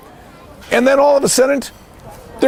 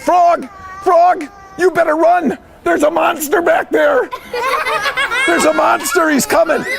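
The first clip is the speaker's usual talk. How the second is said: high, urgent mouse raising the alarm; hammed-up panic for a children's story; alarmed warning; 7 semitones higher than usual. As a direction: warn your friend in a high, alarmed voice. Mouse warning Frog of a monster.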